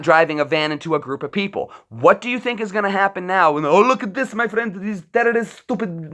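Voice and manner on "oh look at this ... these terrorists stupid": lightly put-on, higher dismissive bystander; a hint of a sneering know-it-all; mocking voice. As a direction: mimic a smug onlooker.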